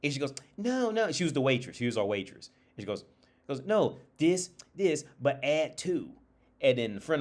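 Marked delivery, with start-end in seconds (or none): 0.6-1.1 higher-pitched quoted "no, no"; waitress correcting. Retold or imitated. Waitress correcting a customer, high-pitched objection. 3.5-6.1 higher, louder imitation, slowing down; waitress explaining. Waitress explains to a customer, emphatic imitation.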